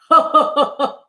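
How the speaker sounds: loud, bouncy ho-ho laugh; Yeti laugh. Acted. Yeti amused by Bear's jumping, bursting laugh. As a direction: laugh in short, big bursts.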